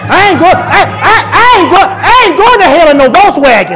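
loud, high mocking brag; mock shout. Preacher mocking a sinner's brag, loud high shout. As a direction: shout it like a reckless boaster.

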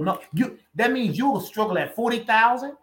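ordinary talk, nothing marked

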